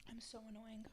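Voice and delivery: breathy whisper to herself; whispered aside. Real, not acted. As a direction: mutter it under your breath. Self-critical aside after a long pause.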